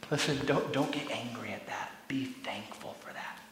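hushed, pleading not to get angry; tender whisper. Real, not acted. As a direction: whisper it gently to the congregation. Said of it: "pastor softens to a whisper".

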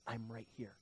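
low, very soft comfort; tender reassurance. Acted. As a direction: murmur it to someone afraid. God's comfort, quoted.

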